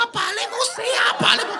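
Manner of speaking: very high mock wail; overacted grief. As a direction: cry out to a dying father, hammed up. Relative rushing to dying father.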